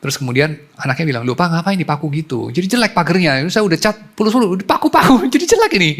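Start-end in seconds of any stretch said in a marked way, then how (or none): 1.3-5.9 put-on rising, louder childish whine; a child protesting, pitch climbing; whiny child complaint. (anak merengek, suara makin tinggi)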